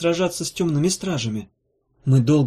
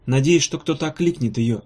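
usual speaking voice

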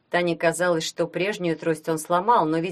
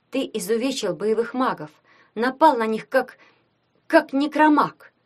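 higher, faltering helpless accusation; 5 semitones higher, 5 dB louder than usual. A narrator's reading. Tanya accuses Gleb of maiming the battle mages, helpless. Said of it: "беспомощно сказала Таня".

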